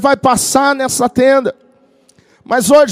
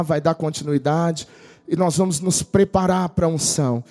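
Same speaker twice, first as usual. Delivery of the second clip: lower, softer voice leading into prayer; calm prayer lead-in; 9 semitones lower, 4 dB quieter than usual. Acted. Pastor preparing the congregation for anointing, lower and softer. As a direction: settle the church down gently.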